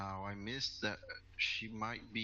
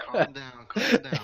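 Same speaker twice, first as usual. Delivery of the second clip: loud, high calm down through laughter; laughing; 5 semitones higher, 16 dB louder than usual. Real, not acted. Player laughing at the hermit joke. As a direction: laugh it out while waving them off.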